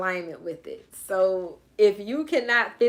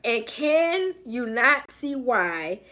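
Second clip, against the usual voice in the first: normal talking voice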